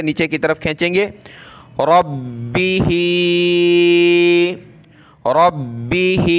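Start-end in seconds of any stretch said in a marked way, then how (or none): 1.8-6.4 रब्बिही with a hard doubled ब and a drawn final ही; doubled ba, long i. Shown as a demonstration. Teacher models रब्बिही for the class, slow recitation. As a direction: lean on the ब, stretch the ही downward. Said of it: "बा को सख्त करेंगे".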